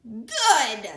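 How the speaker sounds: loud cackling word; cackled good. A narrator's reading. Creature cackling at the eager piglet. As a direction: cackle it with glee. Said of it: "cackled the small creature".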